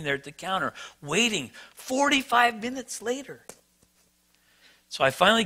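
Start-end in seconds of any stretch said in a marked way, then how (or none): 1.7-3.4 higher, strained exasperation; exasperated repeat. Retold or imitated. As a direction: push it up high, fed up. Repeating the long wait, exasperated.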